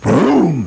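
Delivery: high-pitched mouthed boom; big cartoon kaboom; explosion imitation. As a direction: set off the dynamite with the voice.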